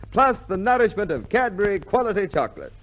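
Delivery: normal talking voice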